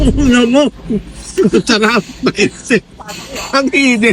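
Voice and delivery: talking around a hot bite; words through a mouthful of hot food; mouth full of hot food. Real, not acted. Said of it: "Napaso ako".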